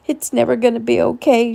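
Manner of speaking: high, tearful despair; crying into his chest, sobbing. A narrator's reading. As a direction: sob the words out. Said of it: "she cried".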